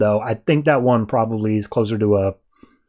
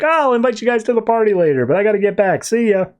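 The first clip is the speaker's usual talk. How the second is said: higher and fast, bubbly farewell; chirpy goodbye; 8 semitones higher, faster than usual. Retold or imitated. Harley chirping goodbye to the family, excited. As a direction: breeze out cheerfully. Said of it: "So she's like".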